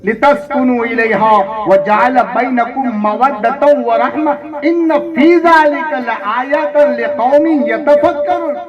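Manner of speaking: emphatic recitation climbing into exhortation; recite, then urge them to listen; rising pulpit intensity; fervent preaching. Acted. Recites verse, exhorts congregation.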